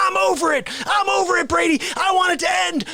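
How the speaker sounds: high, loud mock rant; hammed-up exasperation at a pest; fed-up outburst. Fed-up host rants about his foe.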